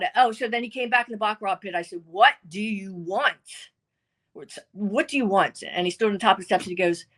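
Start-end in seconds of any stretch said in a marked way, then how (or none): 2.0-5.6 slow, pointed impatience; impatient demand. Retold or imitated. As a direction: drag it out, fed up. Confronting ex in baccarat pit.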